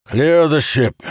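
loud, high, forceful command; commanding order. A narrator's reading. Commander ordering soldiers onto the ship, commanding. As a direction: call the order out to the troops. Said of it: "Calamir ordered".

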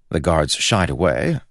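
usual speaking voice

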